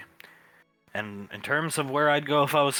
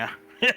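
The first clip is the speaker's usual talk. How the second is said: high burst of laughter; 13 semitones higher, 5 dB louder than usual. Real, not acted. Laugh alone.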